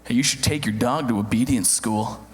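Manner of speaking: mock-patronizing, pitched well down; condescending. Jogging neighbor advising a dog owner, low condescending voice. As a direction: talk down to a clueless dog owner. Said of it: "in a real condescending voice".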